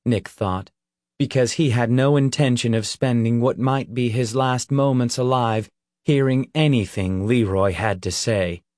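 ordinary delivery

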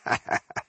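short unvoiced chuckle; quick breathy laugh. A narrator's reading. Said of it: "Ironheart laughed".

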